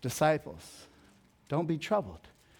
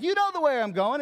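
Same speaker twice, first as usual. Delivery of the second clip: high, louder upbeat assurance; mock-cheery prompting; 12 semitones higher, 5 dB louder than usual. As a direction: playfully voice Jesus prompting the disciples. Jesus retold, same prompting tone.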